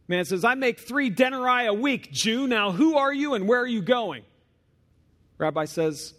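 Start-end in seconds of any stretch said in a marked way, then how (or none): 0.4-4.2 high, loud soldier's retort; gruff mocking soldier. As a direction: sneer it like a Roman guard. Soldier voiced in story.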